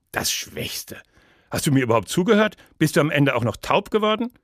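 loud, high, rapid-fire challenge; indignant outburst. Acted. Condemned poet challenging the judge who ranked him last, indignant. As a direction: fire the questions at him, outraged.

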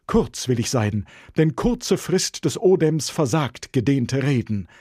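hoch, laut und gedehnt deklamiert; übertriebenes Bühnenpathos; exzessiver Schauspieler. A narrator's reading. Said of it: "exzessiv sprechenden Schauspieler". (Bruder Lorenzo deklamiert übertrieben laut und langsam)

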